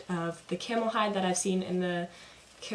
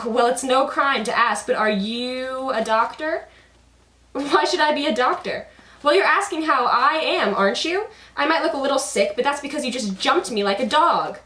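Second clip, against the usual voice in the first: higher, louder, indignant puppet voice; indignant Karagos; 5 semitones higher, 6 dB louder than usual. Acted. Karagos snapping back at Hasavat's question, high and loud. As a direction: take offense and complain loudly. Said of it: "goes over Karagos's head".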